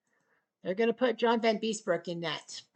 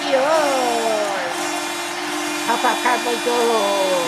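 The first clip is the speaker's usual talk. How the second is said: loud announcer goal yell; goal call shout; 8 dB louder, slower than usual. Acted. Announcer shouting a goal call, loud. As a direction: belt it out like the puck just went in.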